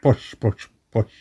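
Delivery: repeated word dying away into quiet; fading echo. Acted.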